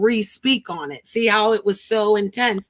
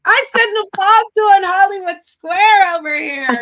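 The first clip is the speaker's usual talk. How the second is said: excited shout; 9 semitones higher, 7 dB louder, slower than usual. Retold or imitated. Excited retelling of spotting stream.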